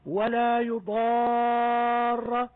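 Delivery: يضار with the aa held a full six counts, very slow; six-count madd. Shown as a demonstration. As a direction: stretch the aa of يضار long before the doubled r. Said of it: "المنتهي بحرف مشدد". (مد ست حركات في يضار)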